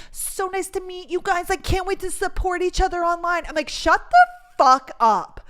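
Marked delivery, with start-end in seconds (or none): 0.1-3.4 fake-nice mimicry. Mocking a rude influencer's goodbye, high fake-sweet voice. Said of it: "nothing I hate more than a fake bitch". 3.7-5.4 slow, loud, higher-pitched outburst; exasperated snap. Retold or imitated. Venting about a phony goodbye, loud drawn-out angry retort.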